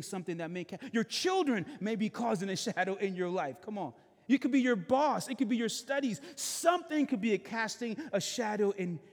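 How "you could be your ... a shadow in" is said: higher, louder preaching build; full-force preaching. Acted. Pastor rousing his Sunday congregation, full-force preaching. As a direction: lift the congregation, building intensity.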